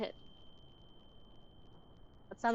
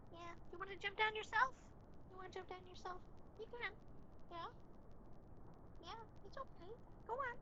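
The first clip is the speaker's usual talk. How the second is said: soft, higher coaxing voice to a pet; 7 semitones higher, 10 dB quieter than usual. Real, not acted. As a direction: gently coax her to jump down.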